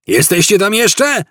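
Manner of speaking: high-pitched searching call; shout. A narrator's reading. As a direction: call out to people in hiding. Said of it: "krzyknął jeden z policjantów". (krzyk policjanta wysokim głosem)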